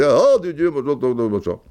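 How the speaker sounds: high-pitched, babbled mock Belgian; hammed-up, clumsy Belgian exclamation; Goethals, bad Belgian accent. Belgian coach greeting him across the restaurant, badly faked Belgian accent. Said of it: "je sais pas bien faire l'accent belge".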